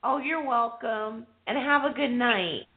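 ordinary talk, nothing marked

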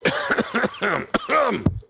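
hard wet coughing; choking on a drink; coughing fit. Real, not acted. Coughing fit, drink went down wrong.

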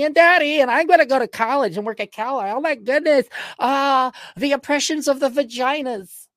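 high mocking imitation of spoiled writers; mocking whiny voice.

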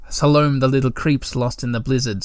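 plain reading voice

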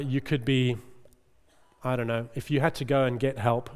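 ordinary talk, nothing marked

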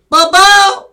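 very high, blasting name yell; imitated scream. Shop guy joins father calling Bo, imitated scream. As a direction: shriek the name like the wild shopkeeper. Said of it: "him and I just started screaming at Bo".

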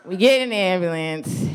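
ordinary talk, nothing marked

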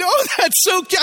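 mock delight. Husband to wife over tiny ice cream scoops, gushing mock delight. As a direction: play along as if charmed.

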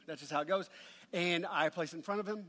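usual speaking voice

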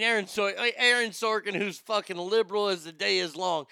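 ordinary delivery

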